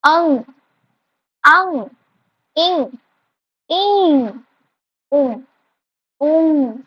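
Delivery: अंग, इंग, उंग each said twice, slowly, through the nose; nasal vowel demo. Shown as a demonstration. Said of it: "जैसे". (नाक से अंग, इंग, उंग बोलना)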